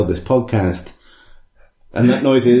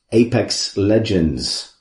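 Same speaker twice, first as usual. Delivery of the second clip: usual speaking voice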